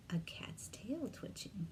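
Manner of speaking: soft unvoiced whisper; hushed aside. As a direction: whisper the detail.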